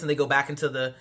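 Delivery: ordinary delivery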